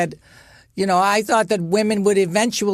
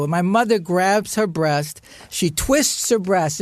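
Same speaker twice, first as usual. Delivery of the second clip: ordinary delivery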